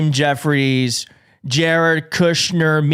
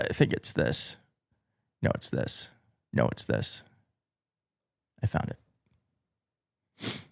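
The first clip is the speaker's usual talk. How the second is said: near-silent whisper, much softer; muttering to self; 12 dB quieter, slower than usual. Real, not acted. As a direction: mumble under your breath while searching. Muttering while searching for video.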